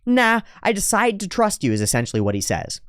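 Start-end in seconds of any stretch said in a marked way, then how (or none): 0.0-1.7 voicing David. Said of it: "And he goes".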